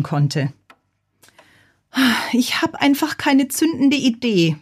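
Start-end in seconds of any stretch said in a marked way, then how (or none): 2.3-4.6 high-pitched frustrated lament; complaining. A narrator's reading. Bazilla laments to her pet hamster, complaining in high pitch. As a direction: moan about it with the pitch held high. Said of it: "beklagte sie sich bei Elvis".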